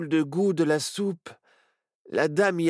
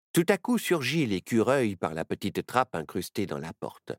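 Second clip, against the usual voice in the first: ordinary delivery, nothing marked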